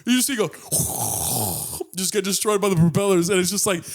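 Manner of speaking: high-pitched giggling narration; can't hold the laughter back telling it; laughing through it. Real, not acted. Laughing while telling grim ending.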